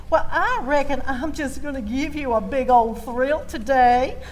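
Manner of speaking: ordinary delivery, nothing marked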